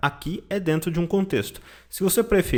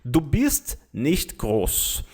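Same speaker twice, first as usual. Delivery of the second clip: slow, lower voiced example line; person A's line; 3 semitones lower, much slower than usual. Shown as a demonstration. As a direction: say it slowly like person A. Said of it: "pessoa A chega e fala para você".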